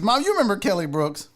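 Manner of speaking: ordinary talk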